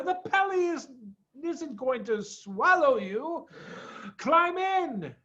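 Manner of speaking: high, lightly voiced monkey. A narrator's reading. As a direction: coax like a cheeky monkey. Monkey voice continues.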